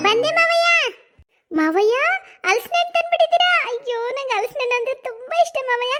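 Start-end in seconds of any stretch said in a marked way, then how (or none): 0.1-2.0 sweet call-out. Acted. Calling out to father-in-law. 2.5-3.5 high, loud delighted question; excited gush. Acted. Excited at the jackfruit.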